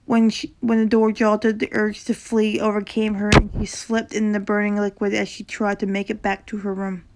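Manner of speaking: ordinary delivery, nothing marked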